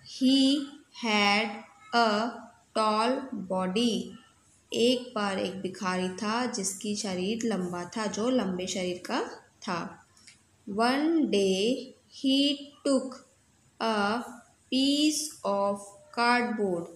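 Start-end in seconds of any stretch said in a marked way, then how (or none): 0.1-4.0 very slow, word by word; slow reading aloud. Shown as a demonstration. Teacher reading the story line to students, very slow. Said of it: "reading aloud to class". 10.7-16.9 very slow, word by word; slow reading aloud. Shown as a demonstration. Teacher reading the story line to students, very slow. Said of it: "reading aloud to class".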